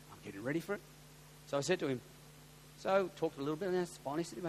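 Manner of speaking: usual speaking voice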